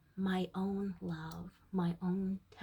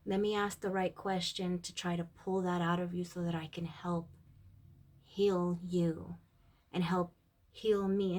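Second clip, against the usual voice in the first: usual speaking voice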